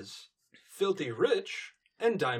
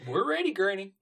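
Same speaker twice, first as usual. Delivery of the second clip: high, louder character reply; put-on pony voice; 8 semitones higher, 5 dB louder than usual. Grandchild reporting ready to Granny, high character voice. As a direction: call back up high in character.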